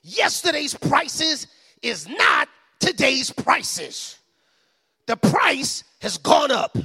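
put-on slow, drawn-out New York accent. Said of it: "in his classic New York accent".

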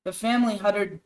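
normal talking voice

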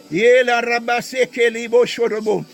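ordinary delivery

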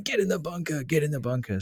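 imitating fans yelling at a golfer, half-hearted; mock crowd yell. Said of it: "there was guys in the crowd yelling".

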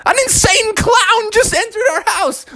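very high frightened cry; hammed-up panic. Parents see a clown in their house, panicked. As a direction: shriek the news in mock terror.

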